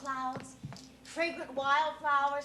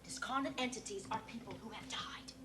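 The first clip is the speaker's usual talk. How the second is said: soft, mysterious whisper; hushed whisper; 4 semitones lower, 7 dB quieter than usual. Acted. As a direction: whisper it like a secret. Medium explains in hushed tone.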